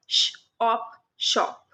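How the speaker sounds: slow phonics blend, sh then ip then ship; sound-by-sound blending. Shown as a demonstration. Teacher to kids, slowly blending sh-words sound by sound. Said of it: "ये श sound बच्चों को आ जाएगा".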